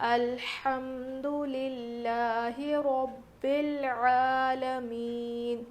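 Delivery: slow melodic tajweed recitation of الحمد للہ رب العالمین; slow melodic Quranic chant; correct recitation. Shown as a demonstration. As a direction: chant the verse slowly with tajweed, not bored. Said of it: "کس طرح سے پڑھنا ہے اس کو دیکھیے".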